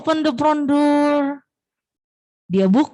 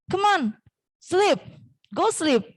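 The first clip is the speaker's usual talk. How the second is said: higher, lightly coaxing command; big mouse urging; 7 semitones higher than usual. Acted. Big mouse sends little mouse to sleep, mild urging. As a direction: urge bedtime in short phrases. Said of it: "Kemudian kata si tikus besar".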